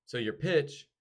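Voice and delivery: ordinary talk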